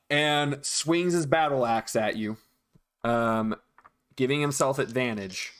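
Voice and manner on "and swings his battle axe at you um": ordinary delivery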